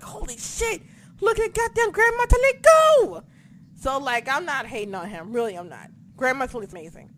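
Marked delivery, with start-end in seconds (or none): 0.0-3.2 high-pitched excited squeal; awed fan. Retold or imitated. Fan reacting to the rope tricks, awed high squeal. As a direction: marvel in a high voice, building louder. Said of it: "I'm just like".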